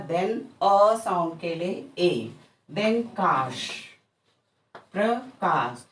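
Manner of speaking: usual speaking voice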